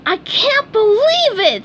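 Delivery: high, loud, slow burst of joy; overjoyed high cry; excited cry. A narrator's reading. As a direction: shout it in disbelief. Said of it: "SpongeBob cried".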